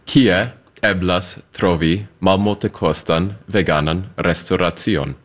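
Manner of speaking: slow "Kie eblas trovi malmultekostan veganan restoracion", spaced out; pronunciation demo. Shown as a demonstration. Guest pronouncing "Kie eblas trovi malmultekostan veganan restoracion" for host, slow demo. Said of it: "ask you to pronounce that sentence".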